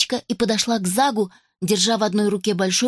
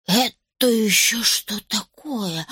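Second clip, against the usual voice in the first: surprised; slower than usual. Acted. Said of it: "вдруг воскликнула".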